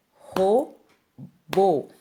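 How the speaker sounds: "robô" broken into two syllables; split into syllables. Shown as a demonstration. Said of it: "é só separar". ("robô" separado em sílabas, mais alto)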